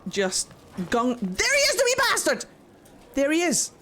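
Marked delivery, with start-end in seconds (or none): 1.2-3.7 high-pitched cry on spotting the culprit; outraged excitement. Real, not acted. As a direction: point him out with a sudden yelp. Spots the culprit in the game.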